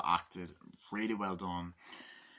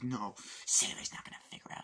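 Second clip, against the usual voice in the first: leering whisper. Retold or imitated. Acting out Dafoe character.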